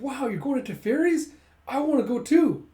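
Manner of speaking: high, loud eager cry; excited outburst. Retold or imitated. Koyomi wanting to join the trip, loud acted excitement. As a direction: act out an excited kid, voice shooting up. Koyomi begs to come along.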